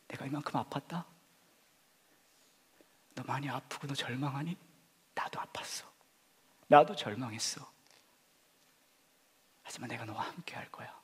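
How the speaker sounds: whispered, intimate comfort; tender Jesus voice. Acted. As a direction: console the hurting like a friend.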